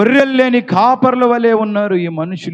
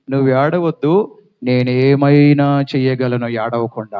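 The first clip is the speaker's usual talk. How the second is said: gentle Jesus; 7 semitones lower than usual. Acted. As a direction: hush her tears gently like a shepherd. Jesus speaks to the widow.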